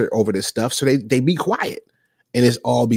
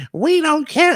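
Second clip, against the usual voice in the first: mock careless followers; slower than usual. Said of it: "they probably".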